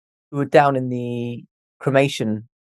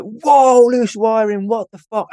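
higher and louder, shocked outburst; startled yelp; 8 semitones higher, 4 dB louder, slower than usual. Retold or imitated. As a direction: jump back as if just zapped. Retold electric shock in abandoned hospital.

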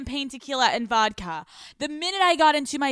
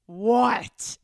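a curt, annoyed "What?" thrown at her sister; mock irritated sister snapping "What?"; pissed off; 2 semitones lower, 3 dB louder than usual. Sister retold as irritated at the hospital, curt annoyed what. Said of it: "Like, pissed off".

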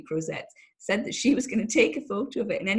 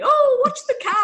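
high, loud fussy panic; a nervous passenger's prim shriek at the driver; Hyacinth McKay; 9 dB louder than usual. Retold or imitated. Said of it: "Hyacinth McKay".

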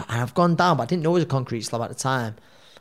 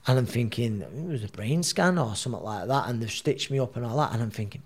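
normal talking voice

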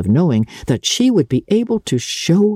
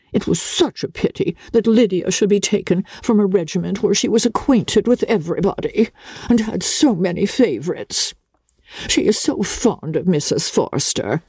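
higher voice of a complaining mother; a higher, put-upon mother's grumble; Mrs Bennet's lament; 7 semitones higher than usual.

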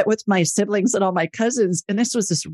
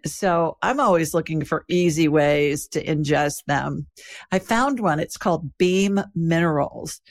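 calm, even sponsor read; 3 semitones lower than usual. Acted. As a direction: read the ad steadily and clearly. Host reads sponsor ad.

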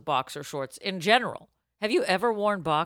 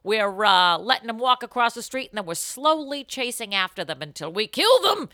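loud, high hyped-up spiel; excited host; 5 semitones higher, 5 dB louder than usual. Acted. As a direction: pump up the audience. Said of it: "hyped host spiel".

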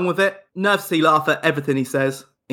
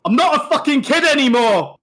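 irate yell; 11 semitones higher, 4 dB louder than usual. Acted. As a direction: yell it like an indignant teenager. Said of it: "Irate, he yells".

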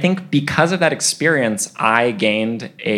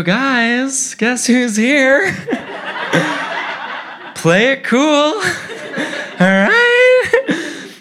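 high, giddy sing-out to the family; hammed-up excitement, pitched way up; excited announcement; 13 semitones higher, 3 dB louder, much slower than usual. Kid brings his idol home to his dads, high and giddy.

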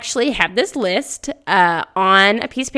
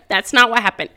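ordinary delivery

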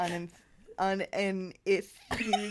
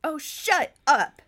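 louder, higher, slow snap; snapping; 7 semitones higher, 8 dB louder, slower than usual. Acted. Cherie snaps back at Anna mid-argument. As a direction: bite the words off at her. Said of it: "she snapped".

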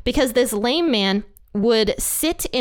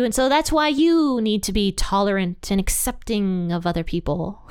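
pastor voice; 2 semitones higher than usual. Acted. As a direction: parody a gushy, tolerant pastor with singsong stretched phrases. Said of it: "that's my best pastor voice".

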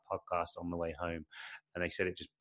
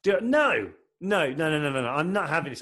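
loud protest; 8 semitones higher, 8 dB louder than usual. Real, not acted. Refusing pineapple pizza question.

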